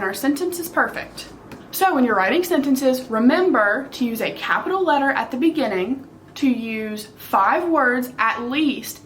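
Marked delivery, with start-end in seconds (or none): none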